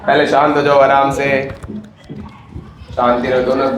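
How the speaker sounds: slow, soothing plea to calm down. Real, not acted. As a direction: settle them gently, slowly. Host calming two fighters.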